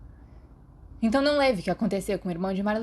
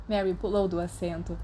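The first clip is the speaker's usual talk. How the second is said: plain reading voice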